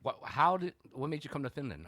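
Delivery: normal talking voice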